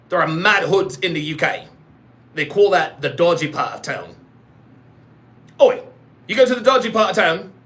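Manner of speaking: mock British street patter; British accent. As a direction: play it as a cocky British local. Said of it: "You talking in a British accent".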